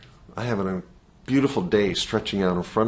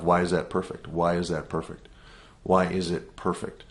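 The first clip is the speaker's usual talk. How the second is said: quiet self-questioning; 4 semitones lower, 7 dB quieter than usual. Retold or imitated. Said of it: "I began to ask myself".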